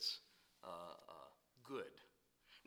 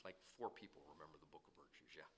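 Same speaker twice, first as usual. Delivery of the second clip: whispered aside; 8 semitones lower, 16 dB quieter, faster than usual. Real, not acted. Whispered joke aside to crowd.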